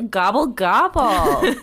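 a hint of turkey in a slow gobble; mock turkey gobble. Joking with co-hosts about turkey men, mock turkey gobble. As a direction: lightly play a turkey, drawing out the words.

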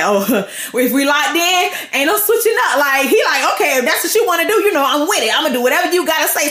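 comic eager-to-please voice; eagerly agreeable Abraham. Acted. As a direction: jump to agree, playing it for comedy. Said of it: "So Abraham like".

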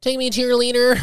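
high squeaky alien. Pretend. Playing aliens abducting them.

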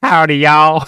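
put-on Texas accent. Playing the Texas train, cheery Texas-accent howdy. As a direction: put on a broad Texas twang. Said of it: "has to be is the Texas".

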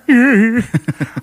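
high, loud cartoon chuckle; Peter Griffin laugh. Shown as a demonstration. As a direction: imitate a goofy cartoon dad's giggle. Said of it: "Family Guy voices".

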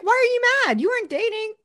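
very high, loud mock disbelief; incredulous retort. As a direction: squeal the question in disbelief. Retelling own disbelieving reaction to character.

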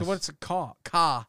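"ca" with the R left off, an open ah; R dropped. Retold or imitated. Host tests his friend's Boston speech, R dropped. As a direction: say "ca" as car with no R. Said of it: "so you omit the R".

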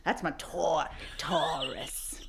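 whispered mobster menace; hushed Chicago gangster threat; friendly threat. Pretend. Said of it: "I was leaning hard into that".

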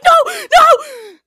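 very high and loud panicked no; terrified screaming. Acted. Catherine tripping on pebbles, screaming in panic. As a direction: scream it while fleeing. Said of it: "She's like".

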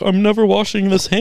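high, starry-eyed cartoon sponge voice; SpongeBob impression. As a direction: squeal it like a dazzled cartoon fan. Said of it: "that episode of SpongeBob".

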